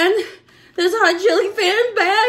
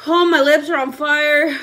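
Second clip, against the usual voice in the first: normal talking voice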